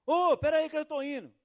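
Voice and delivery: calling back. Retold or imitated. Friend shouts back to the caller, loud casual call. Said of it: "ele sai assim no corredor".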